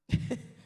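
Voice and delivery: a quick breathy burst of laughter; short laugh. Real, not acted. Preacher laughing at his own joke to the congregation.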